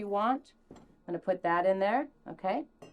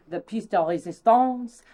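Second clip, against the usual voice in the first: lightly hammed-up French accent, kisses and all; mock French accent; 3 dB louder, slower than usual. Host clowning at the camera in a mock French chef accent. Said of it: "We will speak like this".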